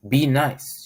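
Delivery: scolding. Acted. Mama Duck warning the ducklings, scolding. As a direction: warn them gently but firmly. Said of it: "she would scold".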